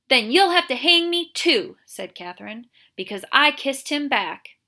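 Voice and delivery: loud high defiant retort. Acted. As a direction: stand up to him, loud. Catherine defiant to sheriff.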